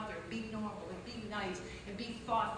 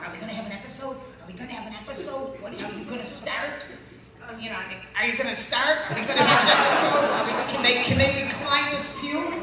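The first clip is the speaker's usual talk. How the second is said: nervous husband; 4 semitones higher, 8 dB louder than usual. Retold or imitated. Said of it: "Richard was going".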